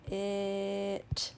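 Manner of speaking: "It" with the vowel held long; slow sounding-out. Shown as a demonstration. Teacher models reading for children, "It" drawn out slowly. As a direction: stretch "It" slowly, one level tone. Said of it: "saying the word slowly".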